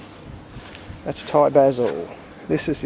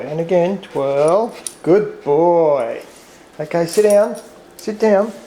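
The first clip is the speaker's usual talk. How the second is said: dog training voice; 2 semitones higher, 5 dB louder, slower than usual. Real, not acted. Owner praising Bobby the dog through tricks, loud and bright.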